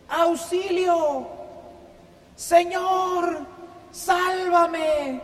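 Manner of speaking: grito agudo y desesperado, muy alto de tono; grito de auxilio. Acted. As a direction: clama auxilio colgado de una rama. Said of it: "Empieza inmediatamente a gritar". (grito lento y agudo pidiendo ayuda)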